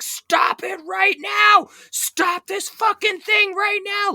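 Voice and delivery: high, strained shout, words drawn out; retold scream. Angry passenger at the bus driver, screaming. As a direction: scream the order, dragging it out. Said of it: "Like, screaming at the bus driver".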